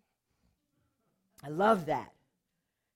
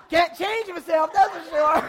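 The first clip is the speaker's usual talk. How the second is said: laughing; 10 semitones higher, 14 dB louder than usual. Retold or imitated. Telling the roommate she cannot change, laughing. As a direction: laugh it out while joking. Laughing at own blackness remark.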